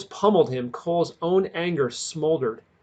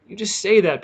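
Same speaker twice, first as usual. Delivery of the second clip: sobbing; 5 semitones higher, 6 dB louder than usual. A narrator's reading. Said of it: "Peter sobbed".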